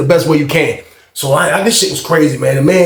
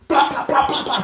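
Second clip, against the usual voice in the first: high, softer popping blows; imitated punch flurry; 10 semitones higher, 6 dB quieter than usual. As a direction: mimic quick punches with your mouth. Imitates more punches landing.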